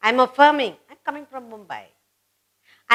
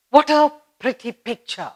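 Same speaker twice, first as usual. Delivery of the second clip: exclaiming; 3 semitones higher than usual. Shown as a demonstration. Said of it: "it becomes an exclamatory sentence".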